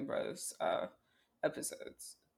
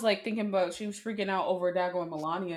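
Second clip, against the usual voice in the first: ordinary talk, nothing marked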